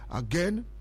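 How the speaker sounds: ordinary delivery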